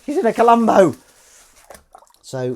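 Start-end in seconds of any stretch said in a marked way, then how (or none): 0.0-0.9 high, loud, starstruck squeal; loud, high-pitched imitation of her own excitement; starstruck gush. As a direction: gush like a starstruck fan meeting a Hollywood star. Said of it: "I was like".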